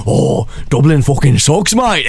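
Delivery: lightly put-on Irish accent, slow; a grumbling Dubliner in a mock Irish accent; Irish accent, drawled and complaining. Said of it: "Everyone's like".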